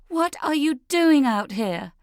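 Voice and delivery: high, loud yell. Acted. Leah yells at her mother in the garden. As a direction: yell it in full outrage. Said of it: "Leah yells".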